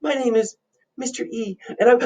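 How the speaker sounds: very high, whimpering nervous character; hammed-up terror with high-pitched whimpers; scared. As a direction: squeak and whimper through the introduction. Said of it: "looks a little scared".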